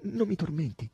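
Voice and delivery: high, halting entreaty; high, anguished plea with pauses; pleading. A narrator's reading. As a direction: beg in small separate pieces. Begging for news of lover.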